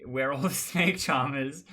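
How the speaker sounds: loud, slow mock proclamation through laughter; laughing group announcement. Four players all claiming Snake Charmer, laughing group announcement. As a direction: proclaim it as a crowd, cracking up.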